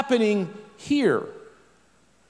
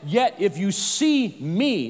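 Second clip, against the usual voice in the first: slow, with weight on "see" and "me"; emphatic stress; slower than usual. Shown as a demonstration. Preacher reading scripture to congregation, adding emphasis, emphatic. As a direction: lean hard and slowly on "see me". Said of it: "I'm adding it for emphasis".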